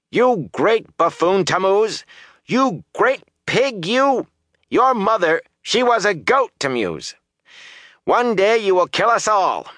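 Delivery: high, scolding woman's tirade. A narrator's reading. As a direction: hurl insults, pitch right up. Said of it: "voices were raised in anger".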